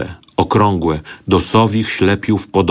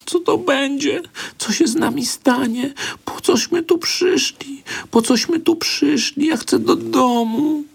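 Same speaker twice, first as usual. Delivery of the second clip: very high, whispered and tearful; a child's frightened plea; terrified girl. A narrator's reading. Girl begs her brother in tearful terror.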